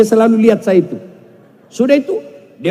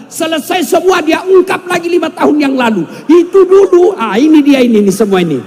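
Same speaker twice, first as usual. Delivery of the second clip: mock exasperated rant; 5 semitones higher, 4 dB louder than usual. Wife dredging up old faults.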